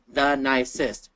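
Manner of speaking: NICEST drawn out in separate beats; teacher's slow, segmented "the nicest"; syllable by syllable. Shown as a demonstration. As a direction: slowly sound out "the nicest". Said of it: "three syllables".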